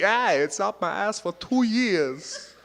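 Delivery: loud, high, drawn-out actor impression; hammed-up celebrity imitation; Christopher Walken. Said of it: "Christopher Walken".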